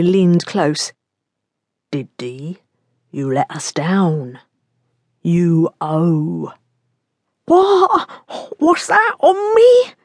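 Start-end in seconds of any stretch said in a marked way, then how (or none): 1.9-6.5 a thug murmuring a debt into his victim's ear; menacing thug. Acted. Thug threatens pinned man. 7.5-9.9 high, louder near-crying plea; a terrified man pinned to a wall, nearly crying; panicked. Acted.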